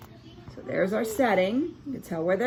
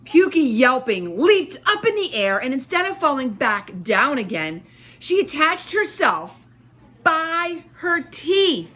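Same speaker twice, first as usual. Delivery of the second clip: dramatic excitement; 5 semitones higher, 5 dB louder than usual. A narrator's reading. Dog leaps yelping, dramatic read.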